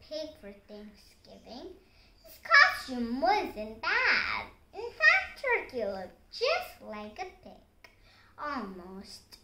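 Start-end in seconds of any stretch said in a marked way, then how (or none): none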